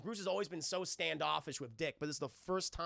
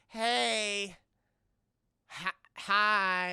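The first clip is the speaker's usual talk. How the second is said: awkward, louder hello in an unfamiliar voice; awkward Bruce; 3 semitones higher, 8 dB louder, much slower than usual. Retold or imitated. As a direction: Bruce unsure how to greet the boy. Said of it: "Bruce is like".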